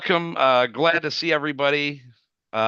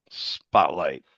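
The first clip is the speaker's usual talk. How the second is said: ordinary delivery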